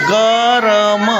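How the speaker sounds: गरम stretched very slowly, sound by sound; careful sounding out. Shown as a demonstration. Teacher correcting pupils' reading, गरम sounded out slowly. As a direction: draw out every sound of गरम. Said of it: "ध्यान से पढ़ो".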